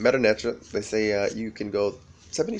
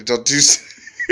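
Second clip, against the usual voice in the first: laughing; 3 semitones higher, 9 dB louder, much slower than usual. Real, not acted. Laughing mid-quote.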